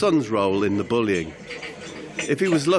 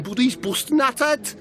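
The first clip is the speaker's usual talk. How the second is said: "busting at it" in a higher Scouse lilt, rising at the end; Scouse phrase; 13 semitones higher than usual. Acted. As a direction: toss off "busting at it" Scouse-style with a rise. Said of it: "He went scouse".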